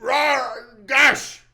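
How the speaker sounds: high, loud growly blurt; dog sounds. Acted. Imitating a silly Family Feud answer, loud high yelp. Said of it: "Dog sounds".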